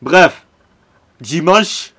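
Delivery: loud, punchy start of a rant; rant opener. Real, not acted. Fan vents his anger to viewers, loud. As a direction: hammer it out like a fan's outburst. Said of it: "Coup de gueule de [name] maintenant".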